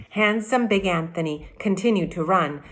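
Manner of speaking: plain reading voice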